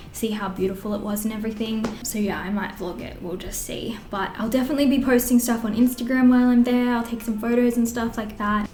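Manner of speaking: ordinary talk, nothing marked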